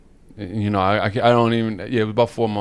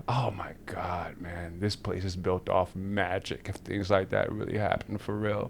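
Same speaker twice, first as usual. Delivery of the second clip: soft, whispery amazement; hushed awe; 9 dB quieter than usual. Real, not acted. Awed aside at the coincidence.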